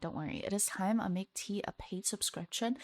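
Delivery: conspiratorial whisper, just breath; whispered aside. Real, not acted. To chat about selling gossip, a sly whisper. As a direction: lean in and whisper it like a secret.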